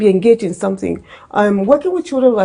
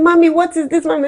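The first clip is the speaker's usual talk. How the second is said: put-on child calling mommy; 7 semitones higher than usual.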